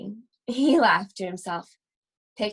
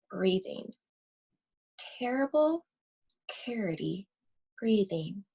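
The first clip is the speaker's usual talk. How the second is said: spooky hush; 3 semitones lower, 7 dB quieter than usual. A narrator's reading. Creepy night breathing described.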